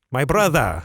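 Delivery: badly faked Russian, punched out; Russian accent attempt. Shown as a demonstration. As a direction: show off a dubious Russian accent. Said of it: "He was more Russian".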